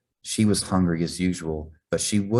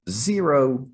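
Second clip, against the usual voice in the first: wailing; 8 semitones higher, 4 dB louder than usual. A narrator's reading. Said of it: "Sophia wailed".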